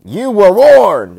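furious, drawn-out high shout; Vader. Acted. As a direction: roar a slow, booming villain's warning. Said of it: "he's like".

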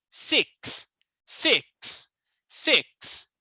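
six said as "siks" with a sharp, crisp KS; six spelled S I K S. Shown as a demonstration. Teacher repeating six for students to copy. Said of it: "S I K S".

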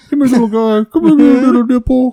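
high, cutesy coaxing voice; playful cooing. Pretend. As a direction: coo as if to a tiny pet.

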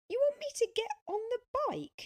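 high, loud astonishment; incredulous question. A narrator's reading. Sophia asked onto a stranger's bike, incredulous. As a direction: squeal the question in disbelief. Astonished at suggestion to ride along.

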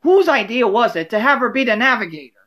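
put-on a fast, snappy old lady quip; Sophia quip. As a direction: Sophia mocking Rose's navigating. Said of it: "Sophia can make a quick quip".

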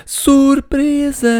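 very high, slow, loud surprise cry; shouting. Acted. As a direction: jump out and shout it. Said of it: "gritou a Millie". (grito de surpresa, alto e agudo)

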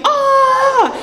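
high held mock scream; comic falling scream, pitched high. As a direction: scream "ah" high without much volume. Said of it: "so I am screaming".

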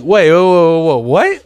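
loud, startled whoas rising to what; shocked double take. Retold or imitated. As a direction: react as if you misheard. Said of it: "But I was like".

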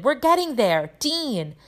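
high, urging call; Professor Lupin. A narrator's reading. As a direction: call the next pupil up. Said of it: "shouted Lupin".

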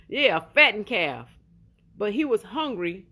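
ordinary delivery

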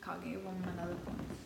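whispered mock despair. Real, not acted. Sulking at her losing bet in a whisper. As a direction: breathe it out like giving up.

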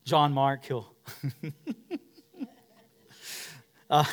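ordinary talk

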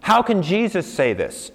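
high, insistent questioning; imagined challenge. Acted. Listener challenges Jesus's claim, high insistent challenge. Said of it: "So you would say to him".

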